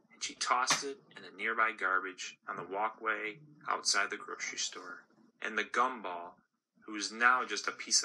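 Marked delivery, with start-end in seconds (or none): none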